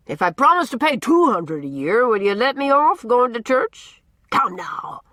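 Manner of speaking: put-on loud, gruff, teasing old man holding forth; blustering old Norman. A narrator's reading. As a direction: boom it out like a cranky, likeable bully.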